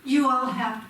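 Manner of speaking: loud, commanding teacher addressing a class. Real, not acted. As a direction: call the room to attention like a teacher. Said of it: "that was my teacher voice".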